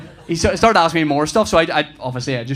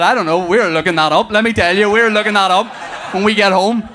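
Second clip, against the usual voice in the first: loud, higher, eager acted reply; raised voice, bursting with mock urgency; excited dad; 5 semitones higher, 6 dB louder than usual. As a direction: play a dad thrilled by the question. Acted dad thrilled by silly question.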